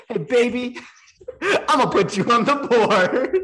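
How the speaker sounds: flirty line through laughter; laughing mock flirt. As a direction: lay on the charm, laughing. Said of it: "He said".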